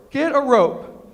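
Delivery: loud, emphatic quoted slogan; booming punchline. Retold or imitated. Texans quote back a sauce commercial, booming it. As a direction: boom out the commercial's line.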